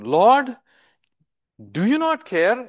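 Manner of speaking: high, loud reproach on Lord; accusatory tone. Retold or imitated. Martha reproaching Jesus while she serves, loud and accusing. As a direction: throw the question at him as a rebuke. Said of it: "Look at the accusatory tone".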